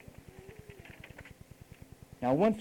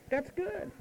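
mock cooing praise; 5 dB quieter than usual. Praising a trembling-lipped listener.

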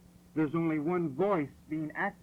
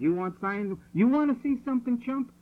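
higher and louder, taunting; mocking God voice; 7 semitones higher, 6 dB louder than usual. God taunting Pharaoh who wants signs, high loud mockery. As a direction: sneer the word chump like a challenge.

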